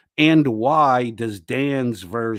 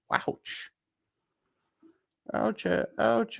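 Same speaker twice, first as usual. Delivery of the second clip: mock ouch; 12 semitones higher, 7 dB quieter, much slower than usual. Reacting to burn.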